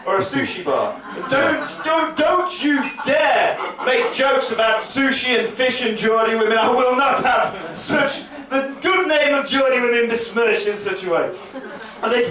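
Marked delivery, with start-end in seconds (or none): 1.3-11.2 slow, pompous indignant protest; mock outrage. Comic defends Geordie women to audience in mock outrage. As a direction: ham up offended dignity.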